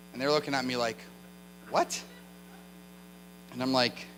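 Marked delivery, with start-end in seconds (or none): 1.7-2.0 mock baffled, voice swooping up; baffled question. Girls staring at a foreigner's bad Portuguese, baffled. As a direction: question it with a steep puzzled rise.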